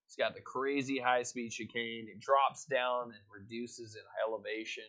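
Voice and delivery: a hint of race caster play-by-play; lightly voiced grid rundown for the stream; race commentary.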